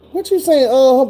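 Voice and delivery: high, panicky question breaking into a nervous laugh; scared pleading. Retold or imitated. Cuffed man asking the officer, high scared plea. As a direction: beg her to explain, voice climbing high.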